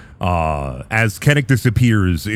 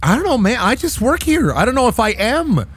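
much higher, helpless shrugging voice; high-voiced arbiter; 12 semitones higher than usual. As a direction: play the overwhelmed arbiter who just works here.

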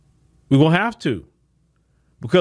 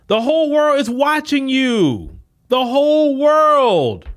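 The whole world drawn out slowly, high and heavy; slow emphatic warning; 7 semitones higher, slower than usual. Real, not acted. Speaker warning opponents the world watches, slow emphatic.

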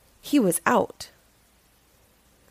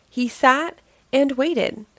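ordinary delivery

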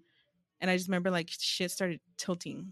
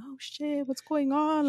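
retold scream; 7 semitones higher, much slower than usual. Friend screaming as the world flips in a dream, mock scream. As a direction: imitate a friend's panicked scream without full volume. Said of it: "he was screaming".

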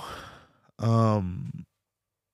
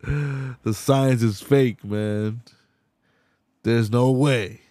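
ordinary delivery

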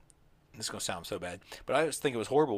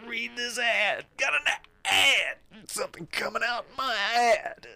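mock announcer; 10 semitones higher, 5 dB louder than usual. Joking on the podcast, a high silly ad voice. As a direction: ham up a fake ad read.

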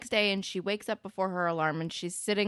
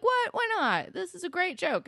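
high-pitched, imitated oblivious joker; mock protest; 13 semitones higher, 3 dB louder than usual. JT jokes on despite her refusal, mock protest. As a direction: protest in a much higher voice, imitating him. JT won't take a hint.

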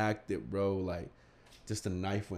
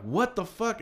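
outraged high exclamation; 10 semitones higher, slower than usual. Retold or imitated. Kids react to prank.

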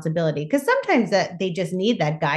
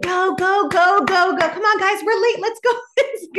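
very high, loud frantic hurry; frantic rushing; 4 dB louder than usual. Retold or imitated. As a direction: rush everyone out the door. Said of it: "I'm like".

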